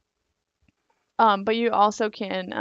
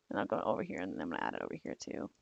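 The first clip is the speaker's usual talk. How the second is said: much lower, softer, voice fading away; voice giving out; 14 semitones lower, 10 dB quieter, faster than usual. Real, not acted. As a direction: let the voice drop and give out. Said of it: "Sorry, I think I'm losing my voice".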